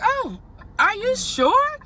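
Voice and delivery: high, surprised squeak of disbelief. Retold or imitated. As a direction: act stunned he would pay. Retelling her own surprised reply.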